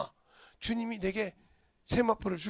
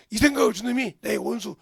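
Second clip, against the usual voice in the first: loud preaching cry; 2 semitones higher, 11 dB louder than usual. Shown as a demonstration. Pastor crying out against the devil, loud. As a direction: repeat it louder, much louder. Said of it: "phrase repeated louder".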